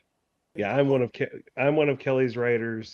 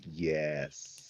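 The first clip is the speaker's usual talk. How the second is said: gleeful yes; 5 semitones lower, 10 dB quieter than usual. Retold or imitated. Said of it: "and I'm like".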